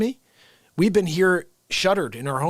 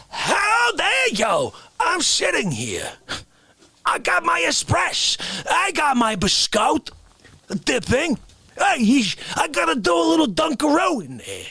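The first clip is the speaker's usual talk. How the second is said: mock outraged shout; 9 semitones higher than usual. Sitting with espresso, shouting fake outrage at Santa. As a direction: yell in fake fury.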